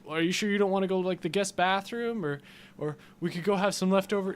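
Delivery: high-pitched, hurried mock suggestion; mock polite stalling. Homeowner steering intruders to another room, mock coaxing.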